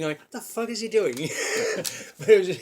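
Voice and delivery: laughing through the words; can't hold back the laugh while asking. Real, not acted. Laughing at himself on camera.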